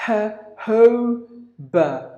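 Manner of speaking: "Jojoba" very slowly as huh, hoh, buh; three separate beats on "Jojoba"; syllable by syllable. Shown as a demonstration. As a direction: breathe an H on each J of "Jojoba", pausing between syllables. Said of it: "and then put two H's".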